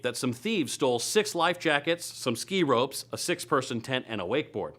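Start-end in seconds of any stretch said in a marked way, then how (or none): none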